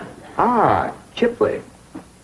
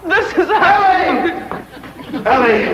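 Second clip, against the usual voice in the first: lovestruck call. Acted. A charmed suitor calling out to Ellie, swooning. Smitten man greets Ellie.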